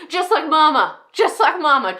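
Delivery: high, loud outburst; unhinged resentment. Acted. Enid likens her pastor to Mama, wildly resentful. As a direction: cry out the old grievance. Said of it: "how insane they can sound at times".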